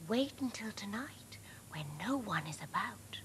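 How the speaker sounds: whispered scheme; conspiratorial whisper. A narrator's reading. Sandra plotting the night raid with Dad, whisper. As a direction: keep it secret and hushed.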